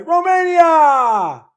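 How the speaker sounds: high, drawn-out celebratory shout; yelling. Real, not acted. Streamer cheers a viewer's country, yelling. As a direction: belt it out high and stretch it. Repeats the yelled hail to viewer.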